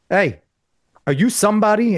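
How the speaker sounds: a hint of Dave Chappelle, rising; Dave Chappelle's way. Young comic on stage to a man with feet up, light Chappelle impression. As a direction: lightly imitate Chappelle's quizzical call-out. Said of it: "in Dave's way".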